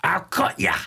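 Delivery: drawn-out, high Aussie war cry settling into a threat; yelled Australian accent. Acted. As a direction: holler slowly in a mock Australian tough-guy accent. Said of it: "So you yell that".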